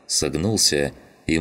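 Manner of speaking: plain reading voice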